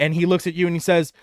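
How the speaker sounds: usual speaking voice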